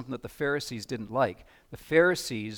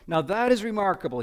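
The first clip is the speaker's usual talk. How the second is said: higher, louder mock amazement; sarcastic; 6 semitones higher, 4 dB louder than usual. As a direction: drip sarcasm on remarkable. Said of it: "Just listen to the sarcasm in his voice".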